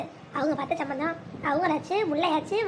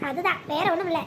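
ordinary talk, nothing marked

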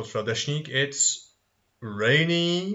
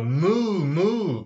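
drawn-out moo, pitched up; lightly imitated cow mooing; 5 semitones higher, 4 dB louder than usual. Shown as a demonstration. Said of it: "animal voices".

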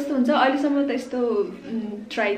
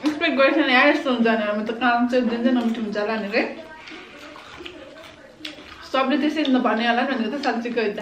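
speaking between bites; talking while chewing. Real, not acted. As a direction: mouth partly full of food. Seen 0: Speaker unclear; all three are biting and chewing peppers throughout.

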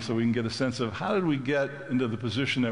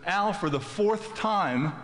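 slow, high, fed-up reply; wife, tired of repeating herself, exasperated; 8 semitones higher, much slower than usual. Retold or imitated. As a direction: spell it out like she's said it four times.

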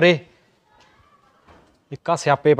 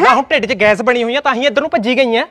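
much higher, louder indignant protest; indignant shout; 6 semitones higher, 5 dB louder than usual. Acted. Husband protests to wife he gossiped with nobody, loud and high. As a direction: shout your innocence.

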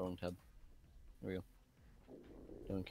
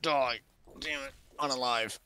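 yelling; 6 semitones higher, 5 dB louder than usual. Real, not acted. Yelling at game enemies.

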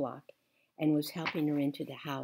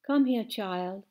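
tender; 5 semitones higher, 6 dB louder than usual. A narrator's reading. Old woman comforts child.

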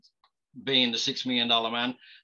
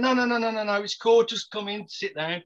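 higher, quick run of eager no's; eager insisting; 8 semitones higher than usual. Retold or imitated. Friend urges him into the casting room, eagerly insistent. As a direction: wave his doubts away.

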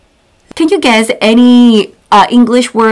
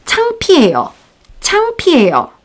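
embarrassed; 6 semitones higher, 3 dB quieter, much faster than usual. Shown as a demonstration. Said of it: "I'm embarrassed".

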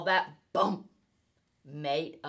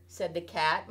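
ordinary delivery, nothing marked

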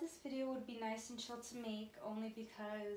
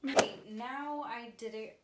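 ordinary talk

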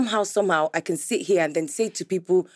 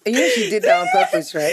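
laughing; 3 dB louder than usual. Real, not acted. Host laughing at guest's trick.

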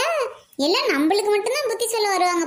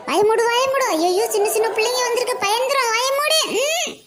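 mock scolding; 6 semitones higher than usual. Scolding her to shut mouth.

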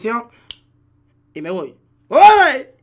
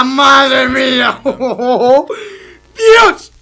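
laughing outburst; 6 dB louder, much slower than usual. Real, not acted. Laughing outburst after a clutch play.

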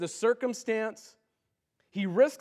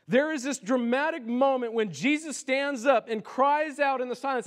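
ordinary delivery

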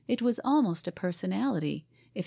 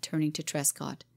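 usual speaking voice

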